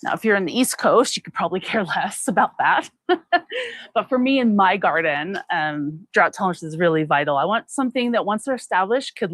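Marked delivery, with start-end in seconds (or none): 3.1-3.9 quick amused high laugh. Real, not acted. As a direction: laugh it off lightly. Laughs at East Coast gardeners.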